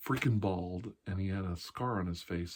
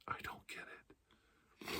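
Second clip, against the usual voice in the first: barely audible, slow whisper; slow faint whisper; 15 dB quieter, much slower than usual. Shown as a demonstration. Comic to audience, trailing slow whisper. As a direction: breathe it out slowly, almost gone.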